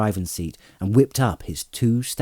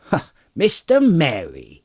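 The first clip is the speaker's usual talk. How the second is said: raised, drawn-out exclamation of recognition; astonished cry; 4 semitones higher, 4 dB louder than usual. A narrator's reading. Farmer recognising the rider as Merry, astonished cry. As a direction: farmer crying out in surprise. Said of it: "he cried".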